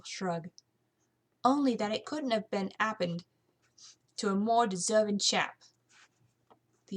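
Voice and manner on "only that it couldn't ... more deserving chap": put-on saucy maid with a British accent; defiant young housemaid; cheeky British housemaid. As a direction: answer back like an insolent servant.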